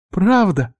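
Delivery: put-on high, hopeful girlish question; delighted question. A narrator's reading. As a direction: ask it high and gladly. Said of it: "повеселели глаза девчонки". (радостно, высоким голосом)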